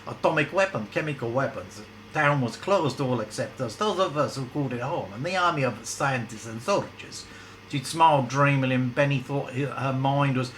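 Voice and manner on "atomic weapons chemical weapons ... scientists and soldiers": a hint of a bad Russian accent; lightly hammed-up Russian woman. Ivana describing her closed town, bad Russian accent. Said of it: "in a bad Russian accent".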